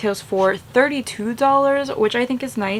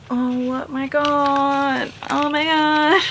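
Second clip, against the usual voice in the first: excited; 5 semitones higher, slower than usual. Real, not acted. Gushing over the singing busts.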